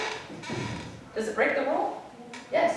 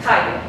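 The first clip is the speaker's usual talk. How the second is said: Kaye with KA stressed, ye unstressed; stress on first syllable; 4 semitones lower, 3 dB louder than usual. Shown as a demonstration. Teacher has students listen for the tonic syllable, first-syllable stress in Kaye. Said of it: "Listen to how I pronounce this word".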